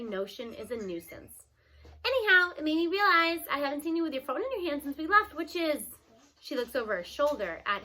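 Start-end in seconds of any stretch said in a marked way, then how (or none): 2.0-5.8 louder, pointed grandma getting to the point; a lightly voiced grandma pressing him. A narrator's reading. Grandma confronts him about phone.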